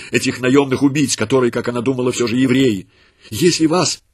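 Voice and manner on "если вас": higher, earnest plea; pleading. A narrator's reading. Mother begging the soldiers to spare her son, high and earnest. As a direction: beg for your child. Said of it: "умоляла она".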